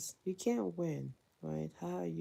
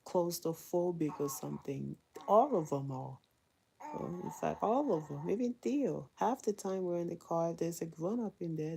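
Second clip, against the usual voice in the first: ordinary talk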